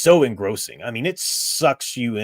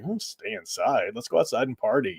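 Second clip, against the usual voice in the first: put-on a higher, hushed, scheming teen voice that brushes the warning off; mocking dumb teenagers; 3 semitones higher, 4 dB quieter than usual. Stupid teenagers scoffing at the warning, in a hushed mock voice. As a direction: sneer it like a careless teenager plotting to sneak out. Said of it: "they're stupid teenagers".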